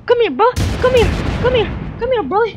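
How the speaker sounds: loud, squeaky beckoning; repeated high, loud calls; high-pitched taunting call. Pretend. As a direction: call the opponent over in a high voice. Calling an opponent during play.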